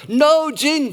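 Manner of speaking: a high, loud retold yell over the noise. Ordering at a festival bar, yelling over noise. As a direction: shout it across a noisy bar. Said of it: "you really had to yell".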